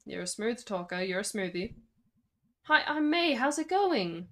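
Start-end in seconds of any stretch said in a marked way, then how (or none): 2.6-4.3 higher, louder chirpy hello; rehearsed greeting. Shown as a demonstration. May rehearsing party greeting in the mirror, chirpy. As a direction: practice a bright, eager hello. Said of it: "I'm gonna do the middle one".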